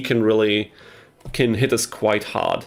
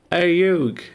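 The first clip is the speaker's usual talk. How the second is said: put-on half-hearted Brooklyn accent, higher; a failed try at a Brooklyn accent; Brooklyn accent attempt; 8 semitones higher than usual. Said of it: "I can't do the Brooklyn accent".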